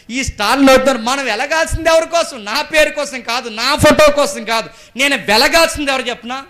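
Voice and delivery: loud, high preacher's rebuke; full-force preaching. Real, not acted. Preacher challenges congregation.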